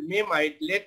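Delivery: normal talking voice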